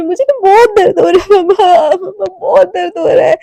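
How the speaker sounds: high, loud sobbing plea with catches in the voice; sobbing confession. Acted. Daughter sobbing to her mother after hurting her friend, crying. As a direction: break down crying to your mother. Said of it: "ऐसे बोल के ना ... रो रही होती है".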